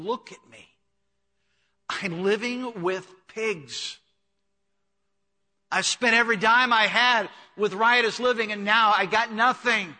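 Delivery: rising, loud, high lament of the son; despairing outburst. Acted. Prodigal son's outburst of despair.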